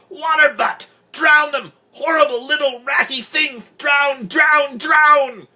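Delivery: hysterical high screeching in fragments; very loud, frenzied howling; enraged howl. A narrator's reading. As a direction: shriek each burst, too angry for sentences. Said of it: "she howled".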